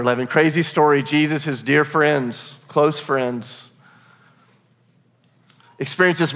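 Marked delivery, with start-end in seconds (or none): none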